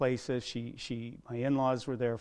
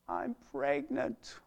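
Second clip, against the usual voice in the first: higher, slow, choked-up daughter's voice; tearful confession; 10 semitones higher, 3 dB quieter, slower than usual. Retold or imitated. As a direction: quote her softly as if through tears. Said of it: "she said".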